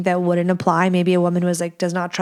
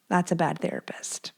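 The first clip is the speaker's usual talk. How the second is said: much softer whispered echo of the verdict; whispered repeat; 8 dB quieter than usual. Real, not acted. Judging her old therapist to viewers, soft whisper. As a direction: whisper it again to yourself.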